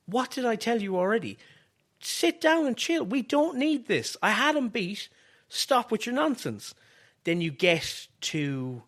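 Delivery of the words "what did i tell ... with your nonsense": high-pitched, exasperated pretend scolding; Trick's telling-off. Wrestler telling off his cheating helper, play-acted exasperation. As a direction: scold a meddling ally in a raised voice. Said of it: "Trick be like".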